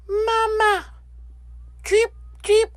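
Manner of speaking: very high, piping chick call; baby gosling cry. Acted. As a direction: squeak it like a newborn gosling. Goslings chirping at bear.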